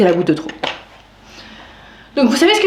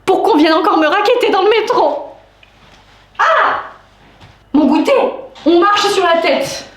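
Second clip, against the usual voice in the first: high, exasperated rant; worked up; 6 semitones higher than usual. Real, not acted. Therapy patient rants about being racketeered in the metro, worked up. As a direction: protest in a raised, wound-up voice. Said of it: "je suis remontée comme un coucou".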